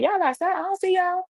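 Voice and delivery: very high-pitched disbelieving question; incredulous. Retold or imitated. Daughter on the phone looking for mom outside, high incredulous voice. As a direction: question it high, as if it can't be true.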